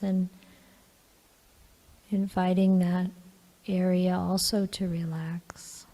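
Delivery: calm, even meditation guidance with long pauses; guided meditation. Real, not acted. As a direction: gently guide them into relaxing, leaving long silences.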